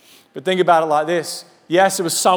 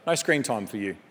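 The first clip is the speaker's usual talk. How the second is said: low, soft mock telling-off; a hint of a stern parent, much lower; mock parent scolding; 8 semitones lower, 8 dB quieter than usual. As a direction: scold like a parent grounding a kid.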